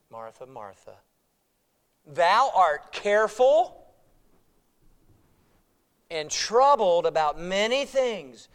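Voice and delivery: tender name, then slow firm correction; loving rebuke. Retold or imitated. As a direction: correct her lovingly, unhurried. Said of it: "the Lord's loving rebuke".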